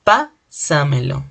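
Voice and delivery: "Pásamelo" slowly, "Pá" set apart and stressed; teacher's slow "Pásamelo", stressed on "Pá"; stress on first syllable. Shown as a demonstration. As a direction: hit "Pá", pause, then run "samelo" together. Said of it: "fuerza de voz está en la posantepenúltima sílaba".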